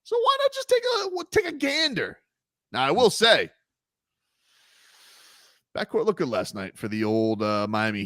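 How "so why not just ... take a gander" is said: playful innocence. Pretend. Teasing about watching Ingram.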